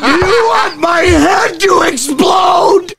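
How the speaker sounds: high, drawn-out comic scream; mock exasperation. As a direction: scream it slowly in mock outrage, half laughing. Mock outburst at confusing accents.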